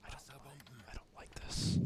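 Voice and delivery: hushed nervous whisper. Real, not acted. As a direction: breathe it out, uneasy. Tense moment, whispering to self.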